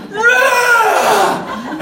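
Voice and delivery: loud, high imitated growl; a sudden hammed-up growl; guttural growl. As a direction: roar it out like a wild man. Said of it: "like this guttural growl".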